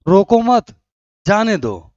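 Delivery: loud "रोको मत", short pause, then falling "जाने दो"; pause after मत. Shown as a demonstration. Teacher acts telling someone not to stop a person, pausing after मत. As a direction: order रोको मत loudly, break, drop onto जाने दो. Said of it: "तो मैंने क्या कहा कि".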